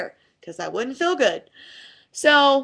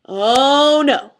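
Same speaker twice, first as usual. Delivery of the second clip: determined oh no; 4 semitones higher, 9 dB louder than usual. Retold or imitated. Seeing her weights set up again.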